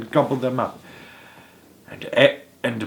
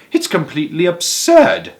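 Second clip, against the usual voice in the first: cried out; 7 semitones higher, 7 dB louder than usual. A narrator's reading. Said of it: "cried Grandpa Joe".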